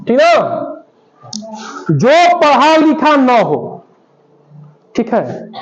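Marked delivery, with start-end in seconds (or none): 1.9-3.8 drawn-out teacher's dictation; slow dictation. Shown as a demonstration. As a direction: read it out very slowly for the class. Teacher dictates the phrase to define.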